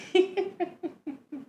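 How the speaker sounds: high-pitched giggle; bright squeaky laugh; high lion cub giggle. Acted. NPC laughs.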